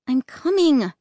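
ordinary delivery, nothing marked

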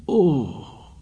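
high falling moan of pain. A narrator's reading. Wounded savage outside the thicket, pained moan. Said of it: "Someone was moaning outside".

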